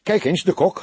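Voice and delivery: high, loud, eager exclamation; excited call. A narrator's reading. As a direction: call out excitedly with pitch jumping up. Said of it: "riep hij opgewonden".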